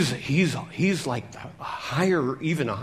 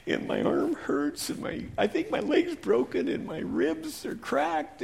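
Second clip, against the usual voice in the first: weak whimper; 10 semitones higher than usual. Acted. Injured man alone at dusk whimpering high and weak. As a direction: barely get it out.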